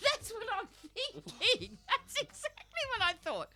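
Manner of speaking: real laughter breaking into the words, loud and high; laughing. Real, not acted. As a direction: laugh it out while agreeing. Laughing at friend's joke.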